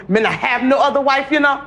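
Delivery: wife imitating her Jamaican husband; lightly put-on Jamaican accent. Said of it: "You do a great Jamaican accent".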